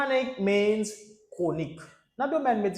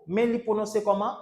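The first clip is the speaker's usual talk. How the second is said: ordinary talk, nothing marked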